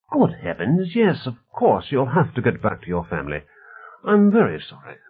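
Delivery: lightly acted surprise turning to apology; apologetic visitor Reedy. Sir George apologises to Stevens for keeping him, polite flustered voice.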